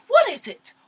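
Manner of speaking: exasperated high pitch; incredulous. Real, not acted. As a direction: demand an answer in mock shock. Demanding answer from Yandy.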